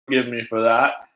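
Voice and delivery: ordinary delivery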